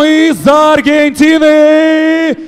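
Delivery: ordinary talk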